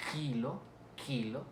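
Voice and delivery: kilo with an airy, exaggerated K at the back of the mouth; velar K. Shown as a demonstration. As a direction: model word kilo, K released with friction. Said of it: "no roza del todo la lengua".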